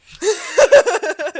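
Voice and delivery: high, loud burst of laughter; real cackle at your own joke. Real, not acted. Host cracking up after the ghost joke, loud laugh.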